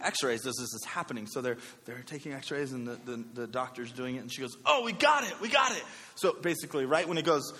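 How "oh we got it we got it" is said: high, loud celebratory cry; excited, high-pitched success call; excited doctor. Retold or imitated. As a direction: imitate a doctor's excited announcement. Doctor announcing success in story.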